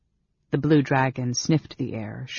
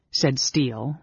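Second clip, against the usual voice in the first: ordinary delivery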